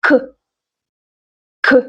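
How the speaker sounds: short "kuh" for K; K as a sound. Shown as a demonstration. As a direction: say K as in kite, not its name. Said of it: "I will pronounce them slowly".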